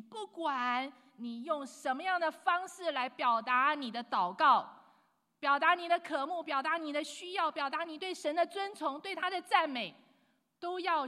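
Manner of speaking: preaching loudly to his church on how to pray; full-force preaching. Acted. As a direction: drive it home to the congregation.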